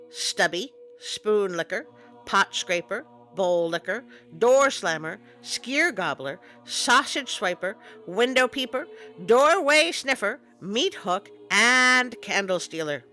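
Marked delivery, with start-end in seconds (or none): none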